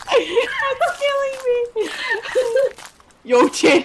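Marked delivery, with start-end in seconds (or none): 0.0-1.9 high, drawn-out words through laughter; slow, squeaky, laughing wail; helpless laughter. Real, not acted. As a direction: squeal it while cracking up. Cracking up at the game.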